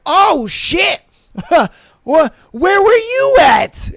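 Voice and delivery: high squealing startled voice, loud and strained; startled donkey. Retold or imitated. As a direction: play the donkey jolting awake in a panic.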